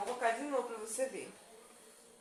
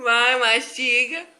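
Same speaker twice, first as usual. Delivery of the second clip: loud urging; 16 dB louder, slower than usual. Real, not acted. Urging the taster loudly.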